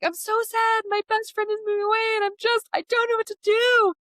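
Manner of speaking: a sad little kid, voiced way up high; upset child. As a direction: mimic a kid wailing about their friend. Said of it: "the child comes to you and is like".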